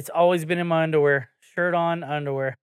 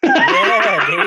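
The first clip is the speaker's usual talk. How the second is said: laughing; 6 dB louder than usual. Real, not acted. Laughing outburst.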